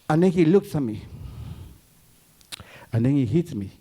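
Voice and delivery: ordinary talk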